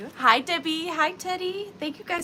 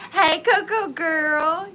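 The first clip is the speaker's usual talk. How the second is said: loud, slow, drawn-out call; big stretched-out hailing; playful catchphrase greeting; 3 semitones higher, 9 dB louder, much slower than usual. Shown as a demonstration. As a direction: belt out "Hey, Coco girl" and stretch it. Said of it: "This sounds so like".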